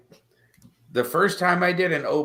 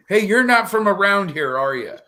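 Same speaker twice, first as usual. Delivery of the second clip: raised, louder imitation of a nosy local; mock suspicious local; 6 semitones higher, 6 dB louder than usual. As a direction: lightly mimic a local sizing up an outsider. Mimics suspicious locals in Georgia.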